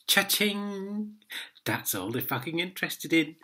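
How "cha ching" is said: mock till sound. Mocking money-grabbing court.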